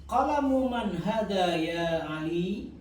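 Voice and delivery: slow question rising at the end; slow model question. Shown as a demonstration. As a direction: ask it slowly for the class to copy. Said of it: "Saya baca jenengan anu ya". (pertanyaan contoh lambat)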